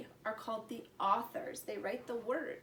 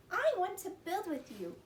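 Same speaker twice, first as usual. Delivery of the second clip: little sister voice; 8 semitones higher than usual. Acted. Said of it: "she said".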